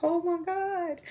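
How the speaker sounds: hushed, high, lingering disbelief; retold shock. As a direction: stretch it out softly in mock shock. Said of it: "I was like".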